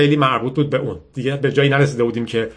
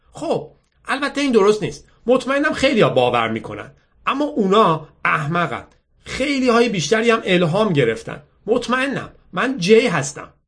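put-on higher-pitched girl conceding, then assured; confident, chatty girl; 8 semitones higher than usual. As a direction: play the self-assured girl introducing herself. Girl introduces herself. (دختری مطمئن با صدای زیر)

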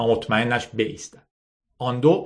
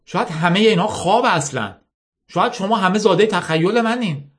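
higher, pointed arguing voice; a lightly voiced skeptic; challenging union leader; 6 semitones higher than usual. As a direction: press them with doubtful questions. Character argues with union men.

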